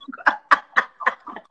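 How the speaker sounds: breathy burst of laughter; real laugh at herself. Real, not acted. Laugh after confession.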